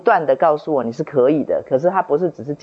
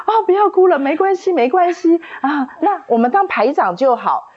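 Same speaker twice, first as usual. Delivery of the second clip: put-on higher, gentle coaxing to a crying child; soothing teacher; 13 semitones higher than usual. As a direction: comfort her like a kind teacher. (老师高声温柔地哄哭泣的孩子)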